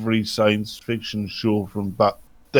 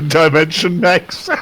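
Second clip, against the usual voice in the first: mock announcer; 5 semitones higher than usual. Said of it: "parody of announcer".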